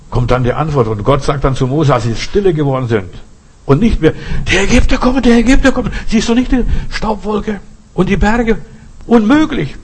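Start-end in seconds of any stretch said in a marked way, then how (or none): 4.5-9.7 high, loud panicky cry; panicked crowd. Retold or imitated. Israelites panicking before the Red Sea, shrill with fear. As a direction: shout it like a frightened crowd at the sea.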